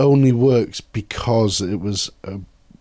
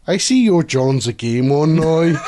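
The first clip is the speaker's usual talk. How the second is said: higher, put-on Northern Irish catchphrase; mock Northern Irish accent; 4 semitones higher than usual. Repeating a bandmate's TV catchphrase, mock Northern Irish accent. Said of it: "she had us all saying this, this phrase".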